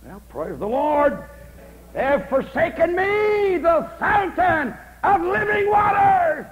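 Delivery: slow, very loud, high-pitched shouting sermon; preaching at full force. Acted. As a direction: roar it slowly from the pulpit like a revival preacher.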